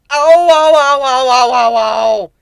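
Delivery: loud high ow ow slowing at the end; pained yelps. Acted. Griffin writhing on the ground with burst eardrums, loud yelps. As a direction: wail from ruptured eardrums.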